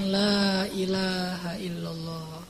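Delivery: drawn out, very slow and soft La ilaha illallah; slow soft recitation. Acted. The Prophet pleads for believers, slow soft creed. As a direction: breathe the creed out slowly and gently.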